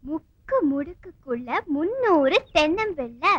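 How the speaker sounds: slow, lilting riddle delivery; riddle posing. Acted. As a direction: pose it like a riddle, drawing out the words. Poses a riddle to the girls. (விடுகதை போடும் இழுத்த தொனி)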